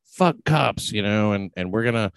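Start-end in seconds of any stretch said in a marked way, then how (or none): 0.0-0.9 defiant shout. Retold or imitated. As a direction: shout it like a protester. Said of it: "to be just like".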